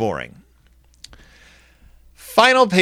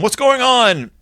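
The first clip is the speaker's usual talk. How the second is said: put-on higher, calling over noisy kids as Papa; mock yell; 9 semitones higher, slower than usual. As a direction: pitch it up over the commotion. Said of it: "Papa tries to yell over them".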